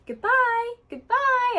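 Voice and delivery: cheerful high goodbye for the parrot; happy little tone. Shown as a demonstration. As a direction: sing it out happily to the bird. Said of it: "a happy little tone to it".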